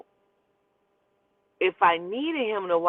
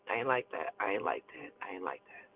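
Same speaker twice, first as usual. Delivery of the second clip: each repeat lower and softer, dying away; fading repeats; 11 semitones lower, 12 dB quieter than usual. Real, not acted. Remembering what happened to her child, fading. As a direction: let it sink to nothing.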